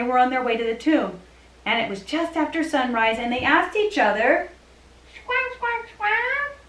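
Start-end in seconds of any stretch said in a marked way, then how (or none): 5.1-6.6 high squeaky bird squawks; squawking puppet. Pretend. Puppet bird talking for women.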